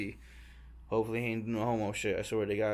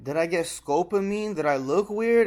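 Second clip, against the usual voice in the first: anxious questions; 6 semitones higher, 5 dB louder than usual. Retold or imitated.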